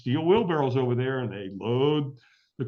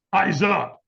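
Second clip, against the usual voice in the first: barked command; 3 semitones higher, 5 dB louder than usual. Retold or imitated. Trainer's sudden command acted out.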